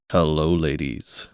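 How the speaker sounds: low, slow smooth flirt; low slow suave greeting; morning voice. Pretend. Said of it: "take advantage of the morning voice and be like".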